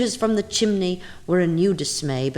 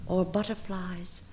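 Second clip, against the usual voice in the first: soft hush; 8 dB quieter than usual. Acted. Bird gliding softly away.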